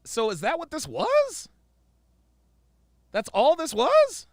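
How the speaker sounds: high-pitched, incredulous questions; incredulo disbelief. Real, not acted. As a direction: let the question shoot up in disbelief. Reactor's disbelief at the clip.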